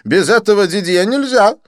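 high, loud, theatrical French friend; excited Didier. Acted. Didier greeting his friend Lin, high excited gush.

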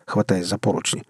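ordinary delivery

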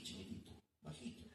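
drops to a hushed breathy "chiquitito"; playful hush for a tiny man; small and quiet. Shown as a demonstration. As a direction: shrink the voice to show how tiny he was. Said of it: "este hermano Secundino era así".

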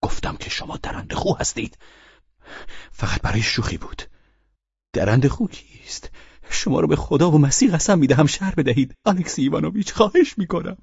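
plead, pitch climbing; agitated pleading drunkard. Acted. Drunken guest begs his host to explain himself, agitated pleading. Voices Pavel Pavlovich pleading.

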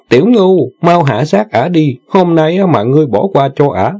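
high, pressing insistence; urging. A narrator's reading. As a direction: hurry him on. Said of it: "thúc giục".

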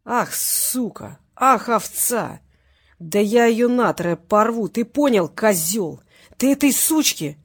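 slow, loud, snarled curses; angry threats. Acted. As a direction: drag out each insult in fury. Crime boss curses and threatens.

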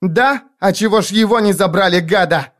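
put-on high, angry girl's cry; shrieked retort. A narrator's reading. Girl challenging the stammerer's explanation, shrieked retort. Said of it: "выкрикнула пацанесса".

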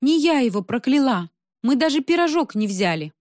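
mildly whining denial; moaning. A narrator's reading. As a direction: plead innocence plaintively. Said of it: "простонала Соня".

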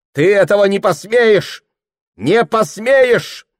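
high, loud desperate shout, slow at end. Acted. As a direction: hurl it back through the door. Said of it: "крикнула".

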